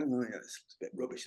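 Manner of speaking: low, soft, deflated mumble; imitated disappointed beginner. Beginner judges their first base coat, low disappointed murmur. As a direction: drop it down, underwhelmed by your own work. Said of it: "You can see them going".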